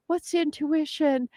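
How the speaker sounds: high, eager novice voice; lightly mocking, high-pitched earnestness; naive younger self.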